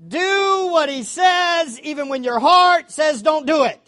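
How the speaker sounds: loud, slow, forceful preaching; fiery preaching. Real, not acted. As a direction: drive each word home from the pulpit.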